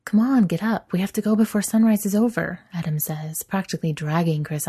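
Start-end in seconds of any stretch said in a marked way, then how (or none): 0.0-2.6 hurried, higher and louder; urgent. Acted. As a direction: rush him out of bed. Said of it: "shaking him urgently".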